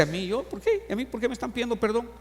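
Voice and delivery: high, startled disbelief; a hint of a startled Moses asking why; surprised Moses. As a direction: jump up in pitch, baffled. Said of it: "de repente sorprendido dice".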